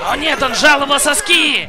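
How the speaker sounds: panicked gamer yell; excited shout. Real, not acted. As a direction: shout it in mock horror. Panicked reaction to kill.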